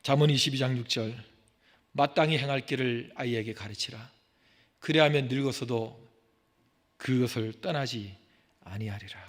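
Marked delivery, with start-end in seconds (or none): 1.9-9.2 scripture reading. Acted. Preacher reading Proverbs to congregation, solemn. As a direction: read the verse reverently.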